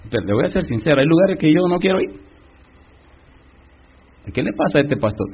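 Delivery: ordinary talk, nothing marked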